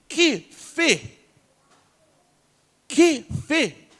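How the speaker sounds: preacher exclaiming in wonder; amazed exclamation. Real, not acted. Marvels at Asa's faith.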